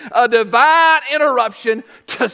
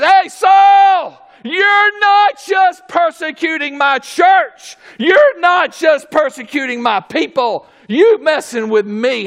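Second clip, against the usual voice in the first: higher, louder warning shout; stern rebuke; 5 semitones higher, 4 dB louder than usual. Acted. Jesus warning Saul, a loud stern rebuke. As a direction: warn him off with force. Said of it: "He says".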